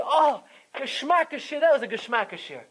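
swept-up, enthusiastic compliment; effusive praise. Shown as a demonstration. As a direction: gush about it in the heat of the moment. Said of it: "saying it b'derech hespailos".

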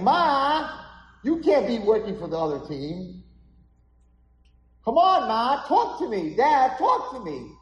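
high, loud acted plea; pleading boy. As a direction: call out urgently to unhearing parents. Boy pleads with his parents.

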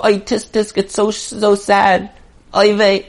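a mild mock tsk-tsk, all talk and no help; mock sigh of pity. To the listeners, mimicking an idle bystander's pity, mock lament. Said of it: "It's not enough to just go".

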